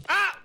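short high cry; high-pitched startled scream; startled yelp. Real, not acted. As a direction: yelp in surprise. Startled by game trap.

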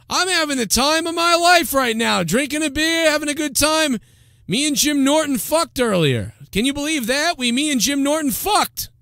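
very high, gloating party-host caricature; Anthony. Acted. Anthony bragging about his great night, high mock glee. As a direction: brag in a hammed-up high voice. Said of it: "Now Anthony would be like".

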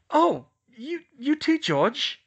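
high, loud Hermione voice, slowing down; lightly voiced girl caught mid-kiss; slow, high, loud words between kisses; breathless between kisses. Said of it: "between kisses".

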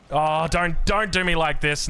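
high, drawn-out pleading whine; dismayed protest. Real, not acted. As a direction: plead against a cruel defeat. Protesting as his attack collapses.

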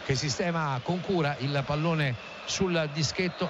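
ordinary talk

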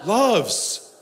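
ordinary delivery

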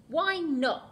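high, loud demanding crocodile. Acted. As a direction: demand it like a spoilt child. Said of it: "demanded the crocodile".

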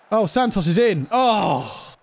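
high burst of hope into a groaned oh; excited near-miss cry. Real, not acted. Player watching Santos get in late in the game, excited. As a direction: cry out high as the striker breaks through. Late chance comes and is missed.

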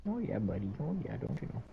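put-on soft, teasing Canadian accent; mock Canadian accent. Teasing a friend over his accent, mock Canadian accent. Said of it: "I tried doing a Canadian accent".

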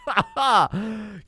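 sudden high burst of laughter; real laugh. Real, not acted. Laughing at own game stats, high burst of laughter.

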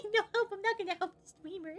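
high, quick, quiet mocking voice; mocking assistant. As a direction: mimic an unhelpful helper in a small squeaky-high voice. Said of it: "sit there being like".

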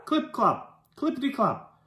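usual speaking voice

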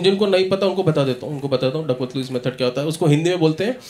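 usual speaking voice

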